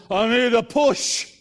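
put-on slow, loud slurred drunk voice; drawn-out tipsy plea; drunk man. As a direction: play the drunk at the door, drawn out.

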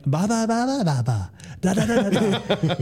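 high, rhythmic gibberish recitation; faking the creed. Retold or imitated. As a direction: bluff along with mock words, breaking into a laugh. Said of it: "the instructors figured it out".

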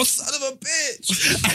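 high, drawn-out yelp of a curse; mock outburst at being hit, stretched out; grito de dolor imitado. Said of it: "every time she beat me and it hurt".

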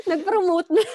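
laughing while saying it. Real, not acted. Guest laughing at her own plug, laughing. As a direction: laugh through the words, caught by your own joke.

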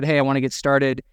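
normal talking voice

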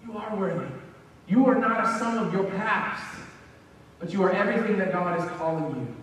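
ordinary talk, nothing marked

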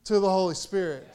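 high-energy pulpit delivery; fervent preaching. Real, not acted. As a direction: preach it with full force, punching key words. Preaches at full force.

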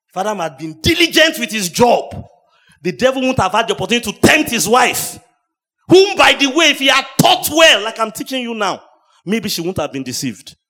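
loud, high preaching, climbing to a shout; full-throated sermon climax; preaching at full force. Real, not acted. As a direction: rise into a shout from the pulpit.

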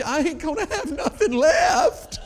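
high, loud, slow mock wail; long-drawn imitation of a desperate cry; hammed-up wail. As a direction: shout it out in exaggerated despair. Retold woman's climactic wail.

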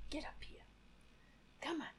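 whispered urging to the pet; whispered coaxing. Real, not acted. Urging the pet to jump up, whispered. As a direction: whisper him up onto the seat.

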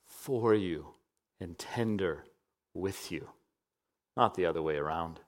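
softly voiced tenderness; tender hush. Tender part of sermon.

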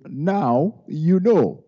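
pointed, stretched-out mock telling-off; mock officer's verdict. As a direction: imitate a cop delivering a lesson, very slowly.

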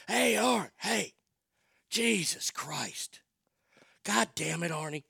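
put-on lightly raspy old dad, half voice box gone; dad impersonation. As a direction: rasp it out like dad after throat surgery. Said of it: "my impersonation of my dad is the best impersonation".

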